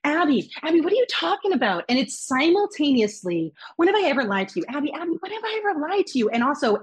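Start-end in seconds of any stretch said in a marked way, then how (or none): 0.0-1.8 higher-pitched mock protest; smooth talker. Guest imitating him cutting her off, smooth-talking protest. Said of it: "it is the smooth talker". 3.8-6.3 higher, increasingly fast mock protest; smooth talker. Guest imitating his denial over her, insistent smooth talk. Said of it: "cannot get a word in edgewise".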